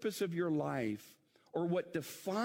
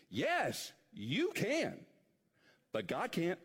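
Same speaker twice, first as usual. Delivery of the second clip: ordinary talk, nothing marked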